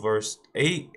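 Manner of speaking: ordinary talk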